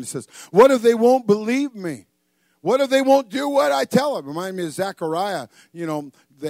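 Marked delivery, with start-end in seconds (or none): 0.5-4.3 high, loud hammed-up fretting; worried protest. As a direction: push the worry up high and loud. Moses frets aloud to God.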